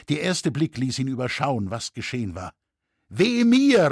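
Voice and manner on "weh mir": high, loud cry of woe; anguished cry. A narrator's reading. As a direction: cry out in dismay. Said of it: "rief er". (entsetzter Wehruf)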